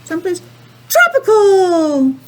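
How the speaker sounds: excited yell. Acted. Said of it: "He yells it out".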